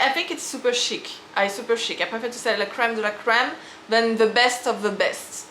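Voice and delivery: ordinary talk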